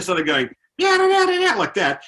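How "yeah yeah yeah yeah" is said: very high, loud vocal guitar wail; mimicked guitar riff. Soldier playing air guitar in square, riff mimicked. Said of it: "he started playing air guitar".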